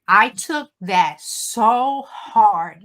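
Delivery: slow, grief-heavy voice; grief-stricken. Real, not acted. Telling the ladies how losing her mom hit her, slow and grieving. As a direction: drag each word out with grief.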